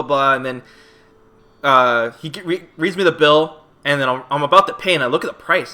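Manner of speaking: normal talking voice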